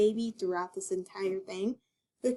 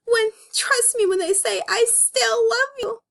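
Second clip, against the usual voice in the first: high, tender comic-book voice; dramatic comic reading; 10 semitones higher than usual. Acted. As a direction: read it like a melodramatic heroine. Reading the comic's tearful line.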